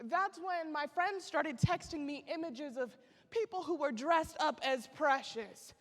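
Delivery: ordinary talk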